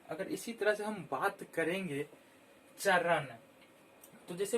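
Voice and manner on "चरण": चरण said loudly and clearly; clear loud demo. Shown as a demonstration. Teacher saying चरण loudly so children hear its letters. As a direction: announce चरण so every child hears it. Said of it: "बोलने से ही पता चल जा रहा है".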